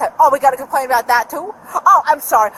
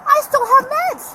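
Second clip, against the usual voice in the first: sarcastic outburst; 9 semitones higher, 4 dB louder than usual. Real, not acted. Sarcastic retort to hospital offer.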